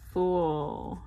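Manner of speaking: "full" said like "fool" in a Southern country accent. Real, not acted. Filling in her planner, country accent slips into "full". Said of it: "that country accent coming out".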